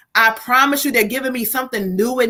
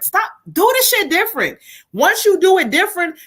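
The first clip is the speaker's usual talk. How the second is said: urgent command; 7 semitones higher than usual. Acted. Urging viewer to act.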